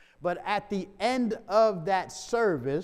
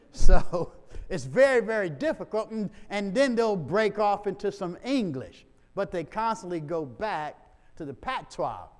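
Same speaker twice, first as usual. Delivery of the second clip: normal talking voice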